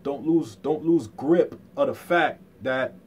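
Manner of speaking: ordinary talk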